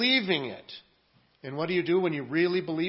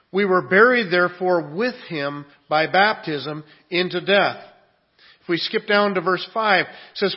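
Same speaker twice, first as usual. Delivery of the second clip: full-force preaching; 2 semitones higher, 8 dB louder than usual. Acted. Pastor to his congregation reading Romans six, preaching loudly. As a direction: thunder the verses out to the church.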